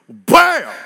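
sudden loud high bam; explosive bam. Acted. Preacher shouting the moment God made the earth. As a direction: hit it like an explosion.

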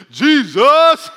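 high, very loud acted holler; shouted call-back. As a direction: belt the name out as a playful cheer. Acts out a shouted public answer.

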